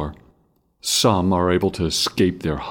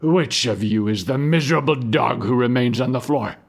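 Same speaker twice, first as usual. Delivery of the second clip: harsh soldier's shout, higher; 7 semitones higher than usual. A narrator's reading. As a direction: yell it like a brutal guard. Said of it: "He shouts".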